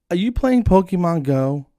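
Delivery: high, loud imitation of her question; imitated eager question. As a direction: pitch it up like the lady asking. Voices the young lady's question.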